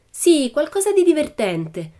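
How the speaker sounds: put-on high, slow girl's voice; Annika, eager. Annika agreeing with Pippi, high and slow. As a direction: draw it out hopefully. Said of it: "disse Annika".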